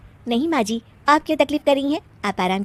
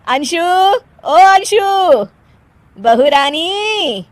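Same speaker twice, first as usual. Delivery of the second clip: long, drawn-out singsong call; 5 semitones higher, 3 dB louder, much slower than usual. Acted. Mother-in-law calls daughter-in-law out to meet friends, calling. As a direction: summon her from another room.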